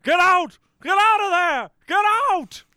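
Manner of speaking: very high, loud frantic yell; Christopher Lloyd impression. Christopher Lloyd screaming at Marty to flee, very high and loud. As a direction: scream the warning in a panic. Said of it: "Your Christopher Lloyd impression".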